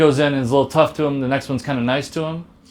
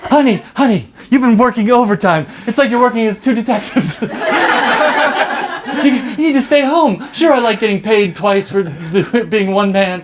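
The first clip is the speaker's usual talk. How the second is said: doting wife; 5 semitones higher than usual. Acted. Wife to her overworked detective husband, higher and doting. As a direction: fuss over him like a fond wife.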